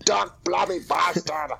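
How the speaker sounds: mock Aussie trash talk; Australian accent. Comic shows how an Australian would talk trash, mock Australian accent. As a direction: put on a broad Australian accent. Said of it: "How would an Australian sound talking shit".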